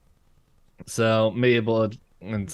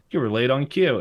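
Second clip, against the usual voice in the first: puppet scolding; 5 semitones higher, 5 dB louder than usual. Retold or imitated. Book puppet scolding the bee.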